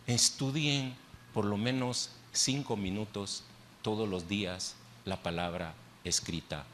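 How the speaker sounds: bajo y grave, con pausas entre frases; consejo solemne. Real, not acted. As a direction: aconseja en voz queda, dejando caer cada frase. Resume el mensaje con solemnidad. (exhortación solemne en voz baja y pausada)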